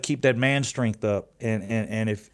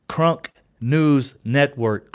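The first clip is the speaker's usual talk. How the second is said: drawn-out slow announcer read, louder; 4 dB louder, much slower than usual. Acted. Host slowly announces his channel name to viewers. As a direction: stretch each word like a network promo.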